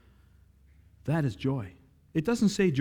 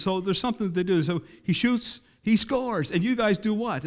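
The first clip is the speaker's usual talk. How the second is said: higher, louder mock emcee voice; imitating the singer; 6 semitones higher, 3 dB louder than usual. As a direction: hype the crowd like a stadium host. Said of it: "He says".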